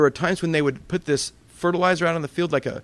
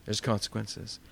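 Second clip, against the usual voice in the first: "consequences" repeated much softer and lower; soft echo; 5 semitones lower, 12 dB quieter than usual. Real, not acted. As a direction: let "There's consequences" sink in quietly. Preacher repeats the key phrase.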